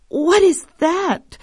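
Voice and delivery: high, slow, alarmed wife; fearful cry. Acted. Farmer's wife hearing frightening shouts, high fearful cry. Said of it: "the wife cried in fear".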